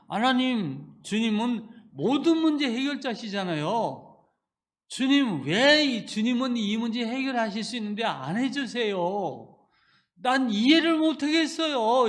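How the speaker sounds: an anguished complaint to God; wailing prayer. Retold or imitated. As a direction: plead with rising despair. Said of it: "이렇게 울부짖으면서".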